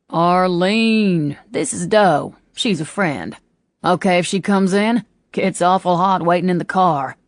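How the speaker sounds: a thick Southern drawl, polite and charming. A narrator's reading. Preppy introducing Doe to Arlene, full Southern drawl. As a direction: lay the Southern accent on thick to charm her. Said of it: "suddenly a full-out drawl".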